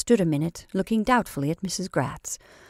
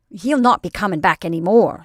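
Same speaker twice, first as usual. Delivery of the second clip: firm, loud and certain; positive; 9 dB louder than usual. A narrator's reading. Mrs Flannery to her friend, firm and certain. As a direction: state it with full confidence. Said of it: "said Missus Flannery positively".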